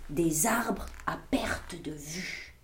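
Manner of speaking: breathy whisper of amazement; whispered wonder. A narrator's reading. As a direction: whisper it as a secret marvel. La forêt magique apparaît. (chuchoté, émerveillé)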